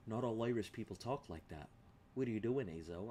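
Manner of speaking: a softly voiced mock complaint; imitated Irish objector. Irish viewer objecting to the accent, softly imitated complaint. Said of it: "he's gonna be like".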